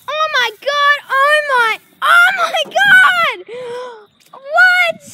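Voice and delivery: screaming high in shock; shrieking jackpot winner. Real, not acted. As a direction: shriek it out, stunned. Dog escapes under the fence.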